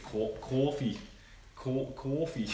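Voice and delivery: imitated New York "coffee"; New York accent. Mimicking a TV cop from Dexter, New York accent. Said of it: "He was from New York".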